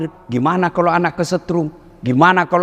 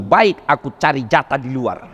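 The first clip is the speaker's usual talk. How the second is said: husband's retort; 6 semitones lower than usual. Pretend. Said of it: "Kata suamimu".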